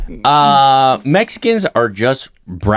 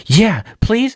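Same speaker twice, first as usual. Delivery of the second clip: put-on eager kid; 7 semitones higher than usual. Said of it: "he was just like".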